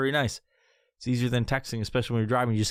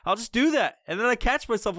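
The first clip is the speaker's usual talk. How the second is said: usual speaking voice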